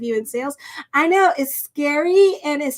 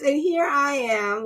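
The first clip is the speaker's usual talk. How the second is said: ordinary talk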